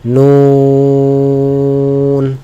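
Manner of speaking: নুন with a very long, level oo; drawn-out letter name. Shown as a demonstration. Teacher introduces the next letter's name, drawn out. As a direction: hold the vowel of নুন on one note. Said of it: "এটা টেনে বলতে হবে".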